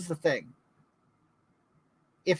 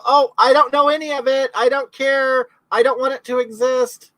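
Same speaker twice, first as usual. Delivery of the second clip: higher, louder sneering mimicry of deniers; mocking dismissive voice; 8 semitones higher, 7 dB louder than usual. Mimicking people who refuse to believe it, sneering. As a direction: whine it like someone who won't listen.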